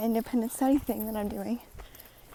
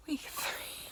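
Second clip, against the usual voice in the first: whispering; 5 semitones higher, 7 dB quieter than usual. Real, not acted. Repeated in a whisper.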